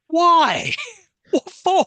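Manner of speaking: squeaky, laughing what-for; incredulous. Real, not acted. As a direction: fire off the questions in high disbelief. Mocking the plot.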